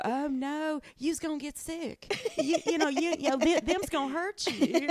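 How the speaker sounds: [name]. Acted.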